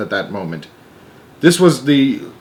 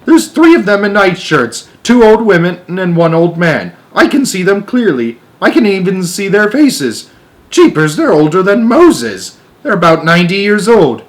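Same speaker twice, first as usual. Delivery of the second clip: frantic; 8 semitones higher, 6 dB louder than usual. Acted. Frantic astronaut insists.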